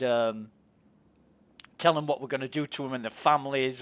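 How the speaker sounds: usual speaking voice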